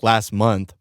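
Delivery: ordinary talk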